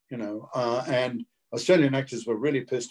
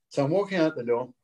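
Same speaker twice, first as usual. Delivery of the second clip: ordinary talk, nothing marked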